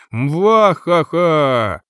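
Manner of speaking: slow hammed-up anime villain cackle; evil laugh. A narrator's reading. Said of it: "изобразила сестрёнка злодейский смех".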